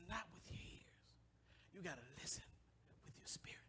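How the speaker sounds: hushed whispered secret; breathy whisper telling a preacher friend to listen with the spirit. Retold or imitated. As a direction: lean in and whisper it. Retells his advice to a friend.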